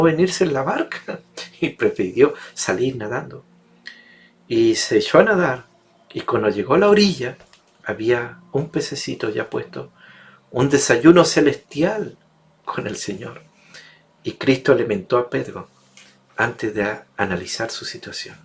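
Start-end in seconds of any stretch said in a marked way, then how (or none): none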